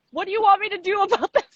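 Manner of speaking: high, fast, laughing disbelief; exasperated laughing. Retold or imitated. Seller baffled by buyer's screenshots, laughing exasperated.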